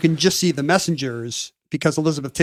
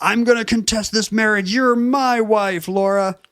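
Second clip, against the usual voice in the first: mock soap villain; 7 semitones higher, slower than usual. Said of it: "And says".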